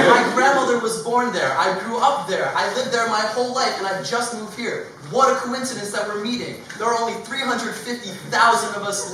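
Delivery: high, loud, overexcited stranger; way too thrilled to share a hometown; overeager gushing. Acted. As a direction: gush like you just met a long-lost countryman. Overexcited stranger gushing about Belize.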